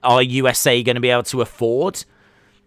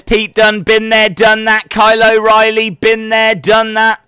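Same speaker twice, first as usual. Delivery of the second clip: quoting Balor; 8 semitones higher, 7 dB louder than usual. Retold or imitated. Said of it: "Finn Balor says".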